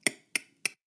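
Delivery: "k" popped with held breath and no air; clicky K. Shown as a demonstration. Teacher shows what the glottal sounds like, clicky K demo. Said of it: "clicky K because it sounds like".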